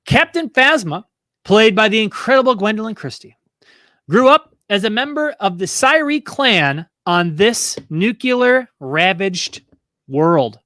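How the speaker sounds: measured quiz host delivery, slowing to the end; slow, dramatic trivia reading; quizmaster question read. Real, not acted. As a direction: read it out grandly, stretching the last words.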